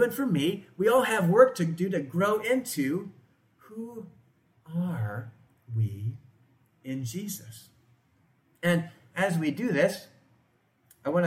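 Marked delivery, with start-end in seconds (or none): 3.6-7.7 each word set apart by pauses, dropping much softer; slow dramatic pauses. Real, not acted. Preacher asks the congregation who we are, word by word. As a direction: let the question land one word at a time. Sermon builds to key question.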